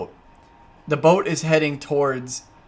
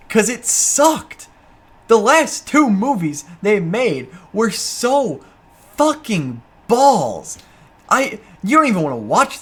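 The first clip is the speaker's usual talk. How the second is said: slow, exaggerated disgust; drawn-out disgust; 5 semitones higher, 4 dB louder, slower than usual. Real, not acted. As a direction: stretch every word out in contempt. Ranting about bad sequels.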